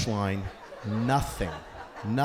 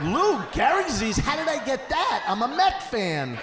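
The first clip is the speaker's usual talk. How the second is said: much higher, louder alarmed cry; panicked disbelief; 11 semitones higher, 4 dB louder than usual. Retold or imitated. Comic panicking at a web search result, much higher and louder. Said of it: "I'm like".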